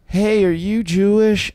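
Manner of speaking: put-on high, slow little boy asking innocently; little kid voice. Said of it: "he just goes".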